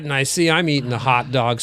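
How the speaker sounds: usual speaking voice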